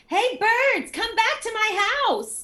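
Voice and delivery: put-on child yelling. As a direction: call the birds back like an excited toddler. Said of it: "continued to yell at the birds".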